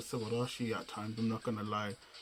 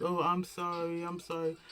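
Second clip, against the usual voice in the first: a light, higher imitation of a timid apologizing girl; half-mocking, meek little sorry; lightly voiced shy anime girl, higher; 4 semitones higher than usual. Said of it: "she was acting all, all shy".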